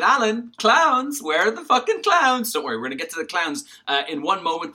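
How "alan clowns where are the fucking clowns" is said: high, loud exasperated shout; impatient demand. Retold or imitated. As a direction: yell it like an impatient viewer. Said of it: "You're thinking".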